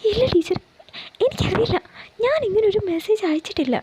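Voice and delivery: put-on high, anxious young woman denying it; pleading Priya. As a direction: plead innocence, high and worried. Priya pleads her innocence. (ഉയർന്ന, വേവലാതിയോടെയുള്ള നിഷേധം)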